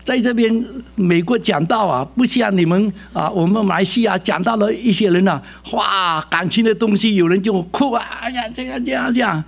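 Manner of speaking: a hint of a chatty friend explaining; mock lively friend telling a story; friend's voice. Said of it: "他说".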